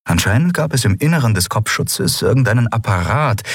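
plain reading voice

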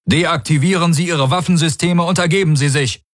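high-pitched, official demand; enemy voice over loudspeakers. A narrator's reading. Enemy officer demanding the ship's surrender, commanding. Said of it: "drang eine Aur-Stimme aus den Lautsprechern".

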